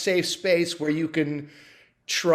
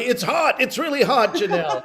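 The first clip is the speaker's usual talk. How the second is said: higher reporter voice with a laugh; field reporter voice; 7 semitones higher than usual. Pretend. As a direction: report live from the scene. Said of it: "speak in a field reporter voice".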